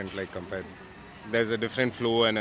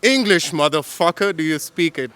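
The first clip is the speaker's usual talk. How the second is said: loud, higher-pitched tough movie line in attempted American; Samuel L. Jackson; 6 semitones higher, 8 dB louder than usual. Shown as a demonstration. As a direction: bark it out like an American film tough guy. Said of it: "Samuel L. Jackson is the guy who says these lines".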